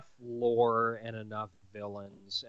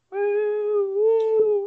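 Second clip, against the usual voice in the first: high, loud whooping imitation; hammed-up celebration whoops; mock cheering; 13 dB louder, much slower than usual. Mimicking Canadians cheering at good news, loud whoops. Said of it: "you're just like".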